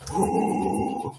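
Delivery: sudden breathless gasp of alarm; startled intake of breath; real sharp gasp. Real, not acted. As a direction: catch your breath as the knife falls. Drops her knife mid-stream.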